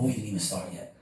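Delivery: ordinary talk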